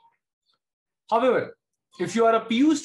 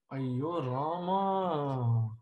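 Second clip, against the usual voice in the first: slow drawn-out dismayed groan; drawn-out lament; exasperated sigh; 4 semitones lower, 4 dB quieter, much slower than usual. Real, not acted. Teacher exclaims in dismay.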